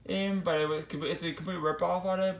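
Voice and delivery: ordinary talk, nothing marked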